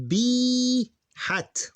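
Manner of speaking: teacher drawing out the long vowel. Shown as a demonstration. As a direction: stretch B then breathe hat. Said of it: "that's a long sound right there".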